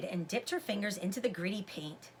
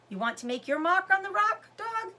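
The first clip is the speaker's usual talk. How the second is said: higher, playful voice for the dog; talking to a dog; 8 semitones higher, 4 dB louder than usual. A narrator's reading. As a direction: tease the dog gently, pitch up.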